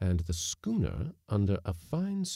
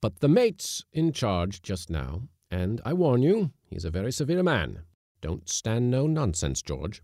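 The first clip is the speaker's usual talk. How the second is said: raised, emphatic warning; stern warning; 6 semitones higher, 5 dB louder than usual. A narrator's reading. Skipper warning the cook about the mate, emphatic. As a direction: warn firmly, pitched up.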